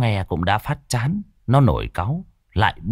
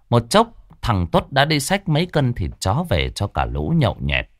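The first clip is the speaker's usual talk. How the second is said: usual speaking voice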